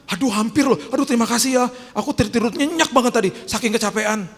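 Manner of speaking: high, loud hammed-up relief; mock grateful Jesus. Preacher mocking a wrong idea, hammed-up thanks. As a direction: play a sleepy Jesus thanking them, mockingly. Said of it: "Emang dia ngomong gitu".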